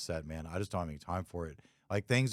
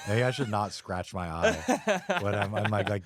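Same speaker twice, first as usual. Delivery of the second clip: laughing; 4 dB louder than usual. Real, not acted. Laughing at scratching eye on clip.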